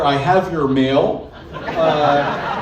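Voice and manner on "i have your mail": slow, lightly official friendly Ohio accent. Shown as a demonstration. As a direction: say it like an official but friendly Ohioan. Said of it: "officially in a friendly manner".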